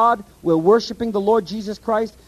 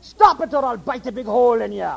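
hammed-up Scottish threat; a jokey Scottish warning; Scottish elder; 4 semitones higher than usual. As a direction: play a mock Scottish elder growling a threat. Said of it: "a Scottish drawl".

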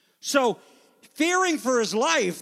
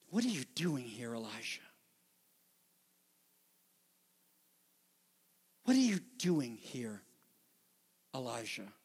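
God's whisper; 10 semitones lower, 8 dB quieter than usual. Acted. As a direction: ask it gently, almost under the breath. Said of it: "he whispers that to us".

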